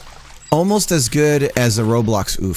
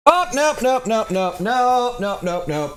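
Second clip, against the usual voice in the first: high, rapid string of nopes; alarmed nope; 11 semitones higher, slower than usual. Real, not acted. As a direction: recoil at something gross, nope after nope. Dog grabs something gross.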